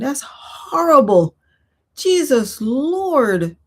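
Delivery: slow, drawn-out appalled exclamation. Real, not acted. Reacting to a viewer's ruined move, slow and appalled. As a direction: drag it out in real horror.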